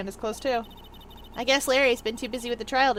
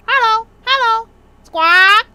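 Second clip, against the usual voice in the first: loud, high parrot squawking; Polly; 11 semitones higher, 15 dB louder, slower than usual. Acted. Parrot greets visitors in the empty shop, squawking. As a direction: mimic a parrot, ending on a rising squawk. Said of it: "Hey, it's Polly".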